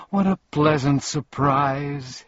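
high, loud, drawn-out delight; happy cry. A narrator's reading. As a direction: cry out slowly in happy surprise. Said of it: "he cried happily".

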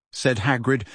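ordinary delivery

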